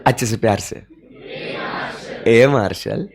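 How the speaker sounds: soft, low, fond call with a small laugh; lovingly. Shown as a demonstration. Teacher repeating the loving call of Marshall for the class. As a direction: coo the name affectionately. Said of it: "वापस एक बार".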